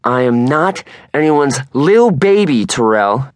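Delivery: loud, high, slow indignant shout; angry yell. Acted. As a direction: snap back at him, offended. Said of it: "Erin gives Terrell a dirty look and yells".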